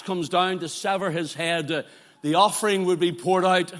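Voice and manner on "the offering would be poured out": ordinary talk, nothing marked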